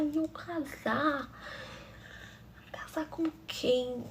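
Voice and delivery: stunned whisper. Retold or imitated. Reação chocada ao casamento.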